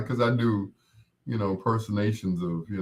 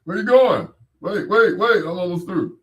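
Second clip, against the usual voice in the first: much higher, loud, hammed-up bully calling out; Big Jake Nasty; 9 semitones higher, 7 dB louder than usual. [name]'s bully character hollering at someone running off, higher and louder. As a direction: yell after him to wait. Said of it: "Big Jake Nasty".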